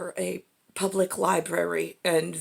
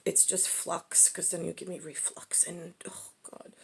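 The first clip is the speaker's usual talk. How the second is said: whisper; 9 dB quieter than usual. Real, not acted. Joking aside about reflux.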